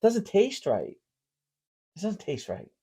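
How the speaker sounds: hushed, doubtful mom impression; imitated mom complaining under her breath; quiet complaint. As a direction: grumble it softly, trailing away. Acting mom's doubt over food.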